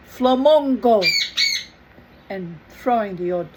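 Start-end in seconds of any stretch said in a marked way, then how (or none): 0.1-1.0 a mock crowd bellow, louder. A narrator's reading. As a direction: call it out like an angry village crowd. Villagers bellowing.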